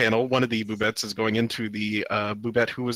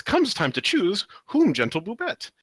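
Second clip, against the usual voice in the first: lightly voiced creature; 8 semitones higher than usual. Creature line read from comic.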